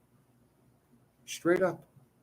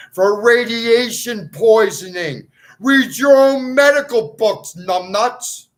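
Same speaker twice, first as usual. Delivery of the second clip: loud, much higher and slow, furious; angry yell; 7 semitones higher, 9 dB louder, slower than usual. Real, not acted. Scolding the doctors, slow furious yell. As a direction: bellow the insult down at them.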